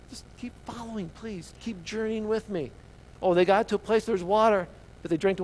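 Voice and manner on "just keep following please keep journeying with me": hushed, higher, patient plea; gentle coaxing. Acted. God coaxing thirsty Israelites onward, gently pleading. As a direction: softly urge them along.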